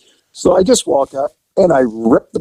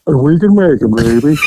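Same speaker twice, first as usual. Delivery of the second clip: mushy, mouth-full imitation of Charles; mumbled Charles voice. Officer fakes Charles's mumble to fool the lookout. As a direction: mumble like your mouth is stuffed. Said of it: "Get that shit out of your mouth and talk right".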